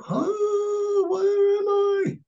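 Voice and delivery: very high, slow mock bewilderment; a lost little boy's wail, drawn out; high, drawn-out mock confusion on arriving at a new school; bewildered. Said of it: "And I'm like".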